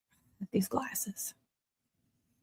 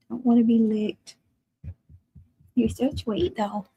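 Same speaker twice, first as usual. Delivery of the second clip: usual speaking voice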